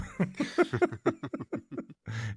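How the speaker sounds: laughing. Real, not acted. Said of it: "laughs at own impression".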